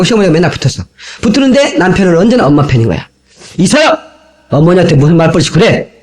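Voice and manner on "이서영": a husband's sharp call of his wife's name, pitched higher; mock yell of a name. Husband scolding his wife for talking back to his mother.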